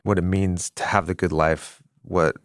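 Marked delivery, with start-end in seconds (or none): none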